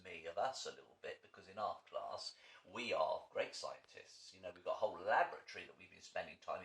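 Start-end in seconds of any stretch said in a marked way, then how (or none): none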